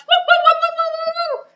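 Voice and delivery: high, loud yapping woos; shrill-pitched barking that fades after the first burst; yapping dog. Acted. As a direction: yap like a small excited dog. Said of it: "the dog yaps".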